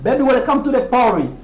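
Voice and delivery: "party" pronounced "PAW-ree", as in the song; American pronunciation. Retold or imitated. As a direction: quote the lyric, softening the T of "party". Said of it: "comment l'Américain la prononce".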